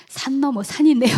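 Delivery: half-laughing exasperation; exasperated lament. Retold or imitated. Exasperated cry to the Lord.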